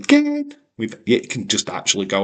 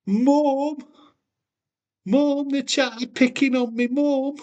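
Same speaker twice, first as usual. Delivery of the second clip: very high wailing Mum; child calling mum. Pretend. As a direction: cry for mum like a tattling kid.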